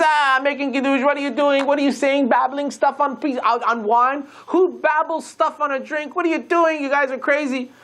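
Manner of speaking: a mocking sneer at the kiddush; mocking scoff. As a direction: scoff at them like it's all nonsense. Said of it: "he starts laughing at us".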